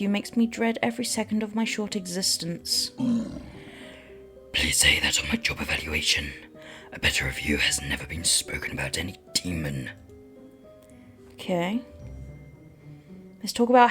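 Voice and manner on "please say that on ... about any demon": deep raspy demon whisper. Acted. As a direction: hiss it like a lurking demon.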